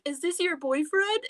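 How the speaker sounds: high-pitched imitation of a curious onlooker; retold teasing question. Retelling someone asking about her boyfriend, high mock curiosity. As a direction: lift the voice high to act the question.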